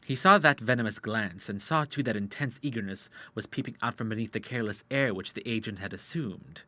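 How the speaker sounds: ordinary delivery, nothing marked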